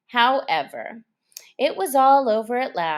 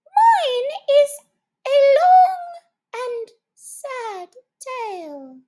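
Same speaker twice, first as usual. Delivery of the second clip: much higher, loud, then a tearful slow fade; sad mouse; 8 dB louder, slower than usual. A narrator's reading. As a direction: squeak it out with a sob.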